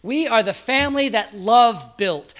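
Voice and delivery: lifted high and loud, leaning on love; a loud, soaring preacher's cry; ringing proclamation. Acted. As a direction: proclaim it to the whole congregation. Preacher proclaims the family's words.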